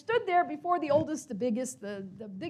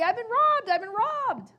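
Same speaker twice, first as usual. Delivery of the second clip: much higher and louder cry; screaming; 9 semitones higher, 4 dB louder than usual. Acted. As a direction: scream it out to the gathering crowd. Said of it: "he screams to everybody".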